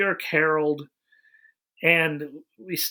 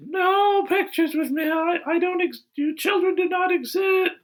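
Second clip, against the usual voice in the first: eerie high-pitched character impression; Peter Isherwell; 14 semitones higher than usual. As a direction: refuse like an odd, inhuman billionaire. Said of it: "you sound a lot like him".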